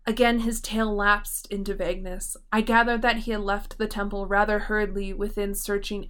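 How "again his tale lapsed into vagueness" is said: ordinary delivery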